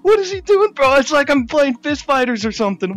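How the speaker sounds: real alarmed shouting; panicked yelling. Real, not acted. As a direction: freak out under attack. Panicking during in-game attack.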